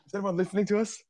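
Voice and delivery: high startled disbelief; mock shock. Host reacting to a friend listening, high mock surprise. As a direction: gasp then blurt it out. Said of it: "And I'm like".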